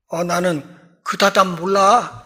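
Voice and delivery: 사람 blurted as 다담, with d sounds; Galilean dialect slip. Retold or imitated. Peter denying Jesus in a fluster, Galilean dialect slip. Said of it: "갈릴리 사투리가 푹 튀어나오는".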